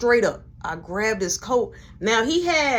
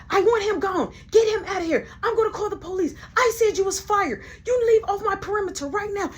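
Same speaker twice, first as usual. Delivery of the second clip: higher, louder demanding voice; angry manager; 11 semitones higher, 3 dB louder than usual. Retold or imitated. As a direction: order him off the premises. Michelle throws him out.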